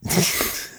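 soft breathy wicked chuckle. Acted. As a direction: chuckle like she's up to something. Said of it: "Tara chuckled wickedly".

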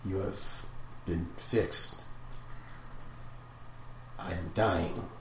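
soft, halting dying farewell; slowly fading voice. Acted. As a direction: speak as a spirit slipping away, with long pauses. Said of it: "that voice slowly start disappearing".

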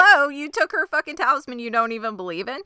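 fast, high-pitched outrage; indignant. Real, not acted. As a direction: scold the absent character at a rapid clip. Indignant reaction to character's theft.

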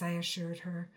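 ordinary delivery

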